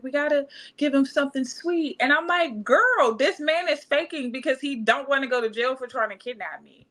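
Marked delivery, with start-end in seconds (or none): 0.0-2.0 quoting mom. Retold or imitated. Mom voiced, urgent caring. 2.6-6.9 exasperated. Retold or imitated. Exasperated retort to mom.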